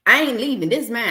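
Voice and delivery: high, loud refusal to leave; defiant refusal. Retold or imitated. Boyfriend refusing to leave the house, high and loud. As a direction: plant your feet and shout him down.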